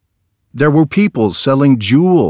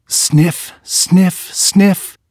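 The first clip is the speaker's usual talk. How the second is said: each sniff pitched higher; sniffing the soup in the air; three rising sniffs; 7 semitones higher, much slower than usual. A narrator's reading. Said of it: "sucked in the air".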